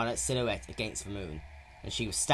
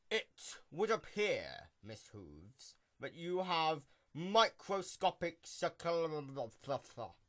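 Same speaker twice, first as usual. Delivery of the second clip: hammy stage actor; 5 semitones higher, 5 dB quieter, much slower than usual. A narrator's reading. Doctor's slow pompous diagnosis.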